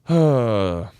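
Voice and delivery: long audible exhale; heavy sigh. Real, not acted. Host reviewing his team's missed touchdown, sighing heavily. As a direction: let out a drawn-out sigh.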